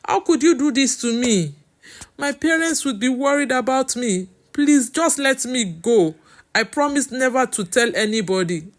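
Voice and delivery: high, loud, desperate begging; distraught plea, easing down at the end; pleading. A narrator's reading. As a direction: beg urgently to be set free. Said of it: "she kept pleading".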